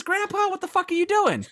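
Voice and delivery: high-pitched mock exasperation; exasperated retort. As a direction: throw it out in baffled disbelief, near laughter. Acts out baffled exasperation at brother.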